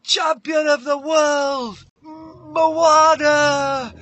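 high, slow, booming title announcement; ring announcer. Acted. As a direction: draw out each word to a roaring arena. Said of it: "they hold up his hand".

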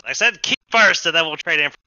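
high, loud firm repeat; insistent squatter. Acted. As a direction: stand firm on the key. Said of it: "He says".

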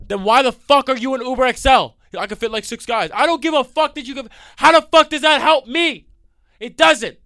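high, loud furious rant; yelling at driver over broken seats; angry yelling. Retold or imitated. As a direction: yell at the driver. Arguing with driver.

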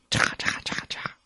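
soft unvoiced chopping sound; whispered chopping. A narrator's reading. Narrator miming the tail cutting thorns, hushed and breathy.

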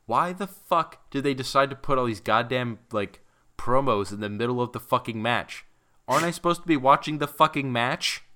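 heated, exasperated rant at the booking; angry rant. Real, not acted. Ranting about promos mid-match.

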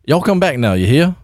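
full-on cartoon Southern accent; Foghorn Leghorn. Shown as a demonstration. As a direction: go all in on a blustery Southern accent. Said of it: "That was so Foghorn Leghorn".

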